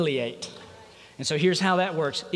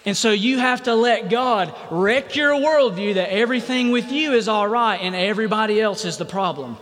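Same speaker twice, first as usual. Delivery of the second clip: ordinary delivery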